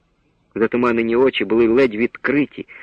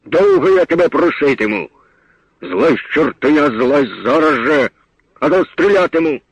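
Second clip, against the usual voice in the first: louder, higher threatening shout; angry threat; 3 semitones higher, 4 dB louder than usual. A narrator's reading. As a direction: threaten him, barking each order. Forester threatens to shoot. (лісник грізно кричить на хлопця)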